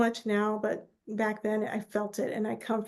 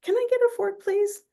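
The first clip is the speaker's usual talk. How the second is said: much higher, sweetly polite little request; dad asking nicely; 10 semitones higher, 4 dB louder than usual. Retold or imitated. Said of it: "you know, very nicely".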